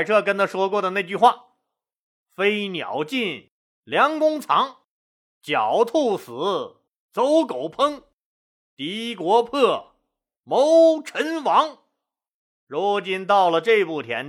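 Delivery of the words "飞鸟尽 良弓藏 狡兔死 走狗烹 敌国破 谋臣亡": very slow, drawn-out proverb with pauses; solemn recitation. Acted. Han Xin recalls Kuai Che's warning, solemn recitation.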